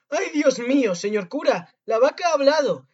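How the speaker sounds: high, loud, alarmed shout; crying out. A narrator's reading. Frightened maid runs to the priest, shouting. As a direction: shout the shocking news. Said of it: "y gritó".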